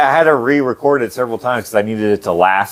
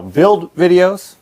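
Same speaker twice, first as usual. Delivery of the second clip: unintended hint of Chef Ramsay, pitched up; raised, slightly British-chef cadence; 8 semitones higher, much slower than usual. Real, not acted. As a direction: say it with an accidental Ramsay-style cadence. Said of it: "you kinda sounded like a".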